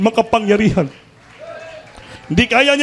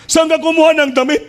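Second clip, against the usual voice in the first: higher, pointed question from the lady of the house; curious employer; 8 semitones higher than usual. Acted. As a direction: ask like a surprised boss.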